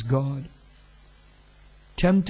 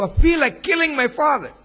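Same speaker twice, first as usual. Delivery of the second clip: emphatic retelling; 8 semitones higher, 4 dB louder than usual. Retold or imitated. Confession retold with more force.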